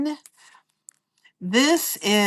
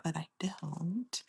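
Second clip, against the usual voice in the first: breathy trailing confession; whispered aside; 3 semitones lower, 17 dB quieter, slower than usual. Real, not acted. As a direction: whisper it under your breath.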